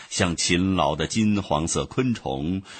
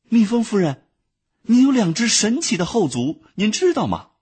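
higher, louder voice full of wonder; admiring ant; 13 semitones higher, 5 dB louder than usual. A narrator's reading. Ant admiringly asks the bee about her hind legs. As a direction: marvel out loud at her legs. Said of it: "怀着钦佩的心情".

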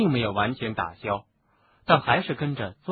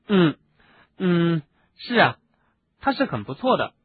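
higher, hesitant concession after clearing the throat; hasty agreement; 5 semitones higher, 4 dB louder than usual. A narrator's reading. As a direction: hem a little, then agree. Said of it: "赶紧说道". (清嗓后略显尴尬地连忙附和)

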